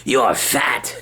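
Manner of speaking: slow, sneering low voice taunting; mean inner voice. Retold or imitated. Inner critic mocking Kyle about ice cream, low sneering voice. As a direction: sneer it out slowly like a bully in your head.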